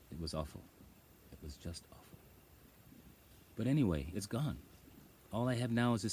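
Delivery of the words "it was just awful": hushed whisper. Real, not acted. Telling viewers how bad the pain was, whispering. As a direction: breathe it out, barely voiced.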